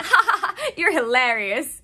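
loud, high fake laughing; mock laugh. Daniel laughing off Kate's claim, hammed-up. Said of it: "And he's like".

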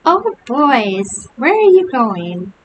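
put-on high, loud, girlish singsong call; shrill girlish voice. A narrator's reading. Billy calling from the stump to fleeing friends, shrill and girlish. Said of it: "shrill, girlish voice".

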